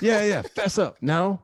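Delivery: high, teasing demand for a confession; milk the mock anger; hammed-up indignation. Player joking with host, demanding a confession in mock anger.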